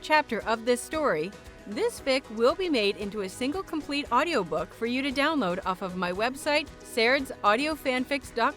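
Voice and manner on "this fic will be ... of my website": plain reading voice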